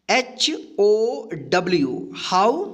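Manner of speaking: normal talking voice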